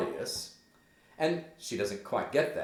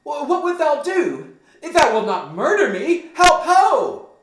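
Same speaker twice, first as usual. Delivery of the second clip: high, loud panicked outcry; scared cry; 15 dB louder than usual. Acted. Gertrude scared Hamlet will murder her, shrieked cry for help. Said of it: "she cries out".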